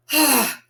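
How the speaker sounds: loud, high intake before the rush of words; sharp gasp for breath. A narrator's reading. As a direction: gulp air before the long name. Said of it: "took a deep breath".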